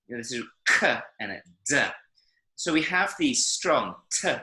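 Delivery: ordinary delivery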